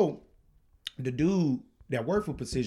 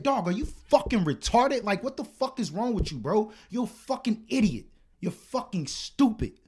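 high-pitched exasperated tirade; snapping; 7 semitones higher than usual. Retold or imitated. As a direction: berate him in heated disbelief. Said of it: "I just snapped".

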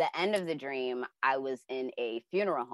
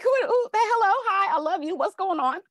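high, louder flustered gush; starstruck; 8 dB louder than usual. Retold or imitated. Dreaming child meeting [name], high, loud and starstruck. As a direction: tumble out the words, thrilled and rattled.

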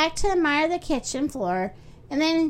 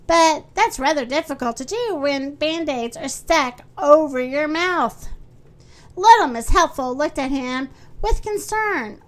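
usual speaking voice

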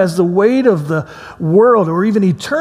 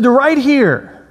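insistent fib; 7 semitones higher, slower than usual. Retold or imitated. Repeating the lie.

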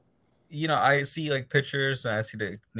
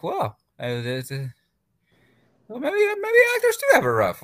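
high-pitched realization; 5 dB louder than usual. Retold or imitated. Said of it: "I'm like".

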